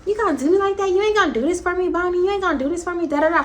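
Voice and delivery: a high, lightly whiny plea to the plug; whiny friend. Retold or imitated. Friend begging her plug, mock whiny plea. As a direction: beg him in a mock pouty voice. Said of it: "'cause she like".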